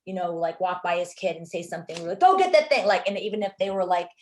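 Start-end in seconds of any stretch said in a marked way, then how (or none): 2.2-2.8 loud, high, stern father's command; sharp shouted order at the kid; barked order. Retold or imitated. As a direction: bark it like a bossy father. Father's actor barking orders in character.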